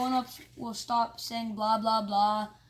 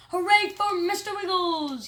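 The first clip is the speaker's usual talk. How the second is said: loud, high cheer; 8 semitones higher, 7 dB louder than usual. Acted. Kids cheering the ferret president, loud cheer. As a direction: cheer for the winner.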